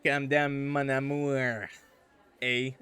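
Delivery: slow French Canadian mon amour, drawn out; French Canadian lover, easing it out; French Canadian accent. Shown as a demonstration. Justin calming Chad, slow French Canadian accent. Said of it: "There you go".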